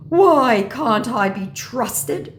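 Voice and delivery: slow, loud, high mock scammer; scammer impression. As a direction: plead slowly as the wronged scammer. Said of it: "said my scammer".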